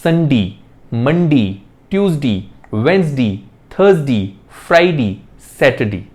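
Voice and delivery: each day ends in dee, संडे as sun-dee; the teacher lists the weekdays ending in dee. Shown as a demonstration. Every weekday said with a dee ending, संडे as sun-dee. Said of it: "डे की बजाय डी भी बोलते हैं".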